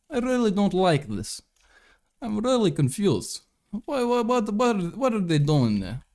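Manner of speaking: high-pitched mock Russian accent. Streamer mocking talk of old politicians, high Russian accent. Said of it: "can I do the Russian accent".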